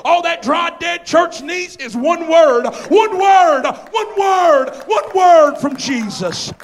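high, loud repeated refrain; full-force preaching. Real, not acted. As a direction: drive each phrase home to the congregation.

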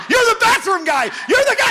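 loud, high excited recognition; sudden delighted shout of recognition. Retold or imitated. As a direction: shout it like you just realized.

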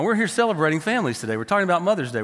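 ordinary delivery

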